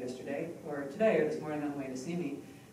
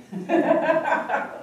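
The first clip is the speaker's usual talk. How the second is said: loud high laugh; 12 semitones higher, 10 dB louder than usual. Real, not acted. Laughs at own example.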